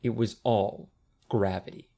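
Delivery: plain reading voice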